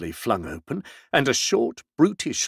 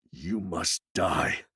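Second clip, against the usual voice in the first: lower, much softer, slow menacing voice; quiet threat; 7 semitones lower, 7 dB quieter, slower than usual. A narrator's reading. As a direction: say it under the breath like a cold promise. Maskell repeats the threat.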